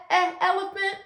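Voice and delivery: "eh" clipped, then "elephant" leaning on the e; sounding out eh. Shown as a demonstration. Phonics teacher comparing sounds for kids, stressed first sound. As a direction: stretch the "eh" before "elephant". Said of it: "cake sound like eh".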